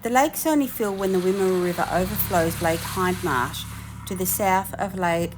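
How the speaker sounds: documentary narration read; voiceover narration. Acted. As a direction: narrate like a nature program.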